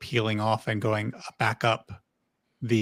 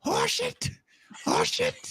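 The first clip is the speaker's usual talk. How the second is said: high, hushed insect squeak; panicked bug voice; 3 dB quieter than usual. Acted.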